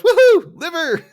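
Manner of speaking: high, loud goofy whoop; Homer. Retold or imitated. Co-host imitating the cheer at the racetrack, high whooping impression. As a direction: whoop it out in cartoon delight. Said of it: "And of course, Homer goes".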